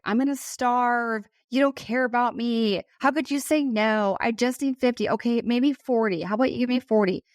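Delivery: hammed-up bratty pleading for money; mock whiny teen. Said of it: "Her daughter went off via text".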